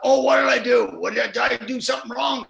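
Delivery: mock fretting, blurted out in a high voice; Don. Actor sure he spoiled the take, mock panic. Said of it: "Don always thought that the reason".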